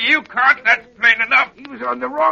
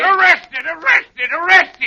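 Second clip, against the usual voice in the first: rising, louder indignant cries; furious repeated threat; outraged repetition; 4 semitones higher, 7 dB louder, slower than usual. Acted. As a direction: hammer the word each time.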